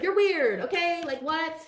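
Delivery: high, incredulous mock outrage; indignant retort. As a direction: throw it back at the haters, baffled and scornful. Mock indignation at jealous haters.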